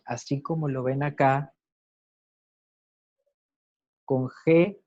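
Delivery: ordinary talk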